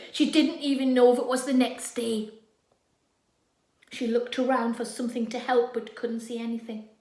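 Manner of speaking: ordinary delivery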